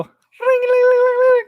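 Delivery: very high sing-song ring ring; mock bell ring. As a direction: chime it like a summoning bell. Mimicking wife's bell.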